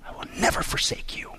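near-voiceless, softly stressed vow; emphatic hushed promise. Acted. Preacher voicing God's promise never to forsake, emphatic whisper. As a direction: drop to an intense whisper on NEVER. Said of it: "Talk about being emphatic from God".

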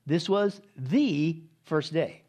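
"This was the first day" very slow and deliberate; emphatic. Shown as a demonstration. Preacher slowly hammers "first day" home to his listeners. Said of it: "Very emphatic".

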